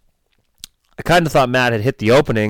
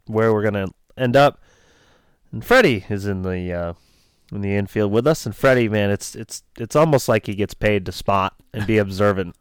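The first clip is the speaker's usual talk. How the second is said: ordinary talk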